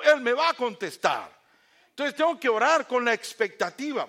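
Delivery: loud, high, rhythmic declarations; full-force preaching. Real, not acted. Preacher urging congregation to expect God's answer, loud and fervent. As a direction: build fervently, pounding each promise.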